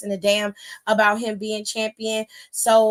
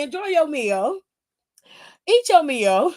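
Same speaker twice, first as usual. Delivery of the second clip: Terry K quote; 3 semitones higher than usual. Retold or imitated. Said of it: "In the words of Terry K".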